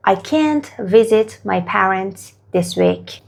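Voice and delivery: very slow, each word spaced out for learners; slow model sentence. Shown as a demonstration. Teacher modelling the answer very slowly for students. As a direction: say it slowly for the class. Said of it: "حالا شما بگید".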